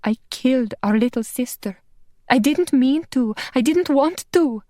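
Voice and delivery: tearful confession. Acted. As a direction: plead through tears, climbing in pitch.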